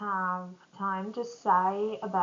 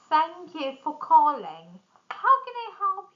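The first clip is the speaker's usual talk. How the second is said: higher, bright customer service tone; friendly phone greeting; 6 semitones higher, 4 dB louder than usual. Shown as a demonstration. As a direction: greet the caller warmly. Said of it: "during phone calls".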